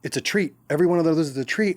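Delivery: excited treat talk. Real, not acted. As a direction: sell the treat with glee. Excited about men's treats.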